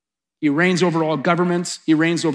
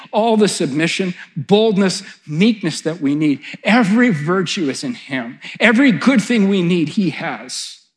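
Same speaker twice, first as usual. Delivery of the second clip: loud, insistent rhythmic list; rousing preaching; 4 semitones higher, 6 dB louder than usual. Real, not acted. Preacher listing what Jesus gives, rousing and loud. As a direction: drive each item home with mounting force.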